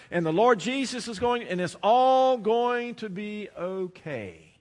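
preaching at full force. Acted. Preacher to congregation, preaching at full force. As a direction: preach it to the rafters, building.

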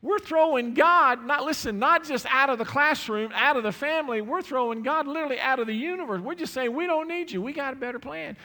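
high, ringing pulpit preaching; preaching at full force. Acted. Preacher warning his flock, high and ringing. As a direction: drive it home from the pulpit.

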